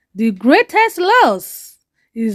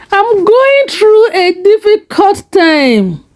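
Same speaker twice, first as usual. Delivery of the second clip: pained confession; 8 semitones higher, 3 dB louder than usual. Acted. Father confesses struggle.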